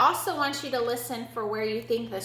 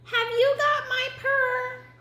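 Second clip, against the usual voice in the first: very high, slow kitten voice; high, drawn-out kitten question; 13 semitones higher, 3 dB louder, slower than usual. Acted. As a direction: ask sweetly as a little kitten.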